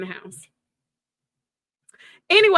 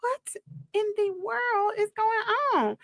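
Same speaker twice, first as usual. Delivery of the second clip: high, hushed bewildered question; lost, asking in confusion; mild, soft bewilderment, pitched up; 9 semitones higher, 4 dB quieter than usual. Retold or imitated. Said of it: "And I'm like".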